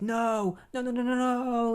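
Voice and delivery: backing away in panic; panicked denial. Retold or imitated. Clone fleeing Iris and Barry, a high panicked no. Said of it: "Wally's just like".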